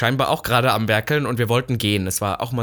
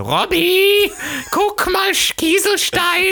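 very high, slow, excited squeal; hammed-up high voice of a starry-eyed hiker; mocking friend; 4 dB louder, much slower than usual. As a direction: mimic a childish friend thrilled by pebbles. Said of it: "du wärst so".

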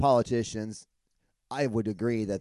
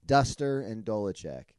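ordinary talk, nothing marked

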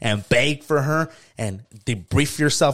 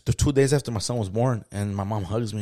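normal talking voice

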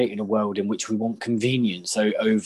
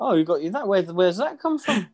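high, mock-innocent surprise; feigned innocence; 9 semitones higher than usual. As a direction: play dumb about the gift.

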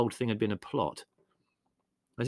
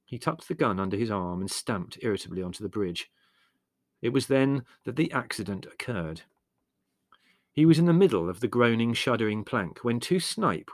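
ordinary delivery

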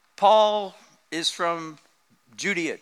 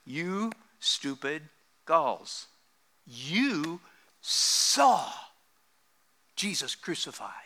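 slow, soft, ending in a whisper; hushed slow quote; 6 dB quieter, much slower than usual. Retold or imitated. Preacher quoting Paul to the congregation, slow and whispered. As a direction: let it fade to a whisper on "Jesus crucified". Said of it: "he says".

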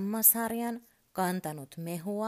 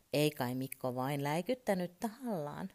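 usual speaking voice

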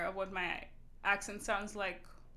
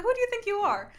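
higher, louder mock challenge; fake indignation; 9 semitones higher, 5 dB louder, faster than usual. Older sister challenging the teenager's new accent, mock indignant. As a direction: retell a put-on scolding of a sister. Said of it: "I sounded so fake".

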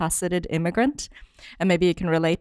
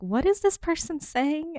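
much higher, bemused inner thought; puzzled inner voice; 11 semitones higher than usual. Retold or imitated. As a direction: voice your own confused thought, pitched well up. Voicing her own puzzled thoughts.

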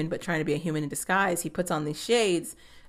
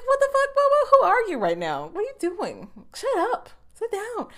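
high, much higher put-on squeal of disbelief; mock-scold the character like a shrill friend; exasperated scolding; 3 dB louder than usual. Recapper scolds Bobo in a high, disbelieving squeal.

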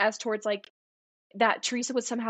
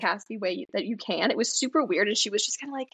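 ordinary talk

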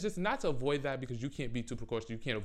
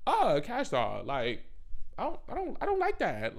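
mock prissy disapproval; 8 semitones higher than usual. Speaker telling Cash Doll off in mock sweetness. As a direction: sing it up high like a polite brush-off. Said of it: "So I'm like".

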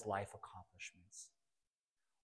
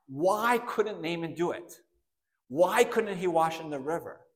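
ordinary talk

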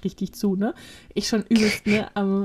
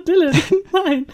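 laughing no; 7 semitones higher, 5 dB louder than usual. Retold or imitated. Refusing Dylan's laminate idea.

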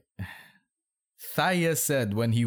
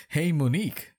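higher-pitched call to a friend; mild yell; 7 semitones higher than usual. A narrator's reading. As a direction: call out lightly across a distance. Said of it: "someone yelled".

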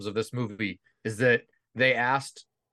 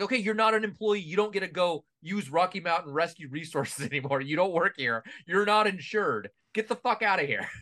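higher-pitched, exasperated mock dismissal; play-acted indignant boss, pitched up; mock telling-off; 6 semitones higher than usual. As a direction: lay down the law, half laughing. Acting out an imagined dismissal.

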